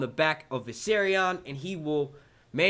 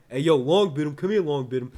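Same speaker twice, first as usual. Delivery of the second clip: put-on louder playful New York accent calling out Longbottom; 6 dB louder than usual. Streamer calls Longbottom over in a mock New York accent. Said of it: "there goes that New York accent again".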